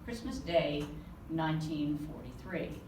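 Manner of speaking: ordinary delivery, nothing marked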